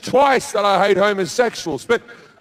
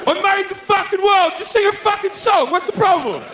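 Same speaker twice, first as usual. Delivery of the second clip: much higher, indignant divine whine; petulant God; 14 semitones higher than usual. Acted. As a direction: throw a tantrum as God. God loses temper.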